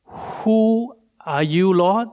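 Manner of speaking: "Who" drawn out very slowly, rising; Paul, slow and awed, to the voice; slow awed question. Acted. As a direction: ask it in slow wonder. Paul asks the voice.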